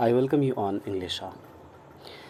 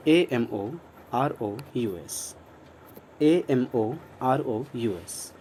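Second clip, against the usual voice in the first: slow spelling out; much slower than usual. Shown as a demonstration. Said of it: "इसका pronunciation है amorous".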